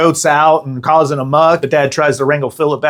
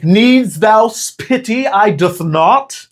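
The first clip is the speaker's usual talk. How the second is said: mock olde English; 8 semitones higher, slower than usual. Mock archaic sister reply.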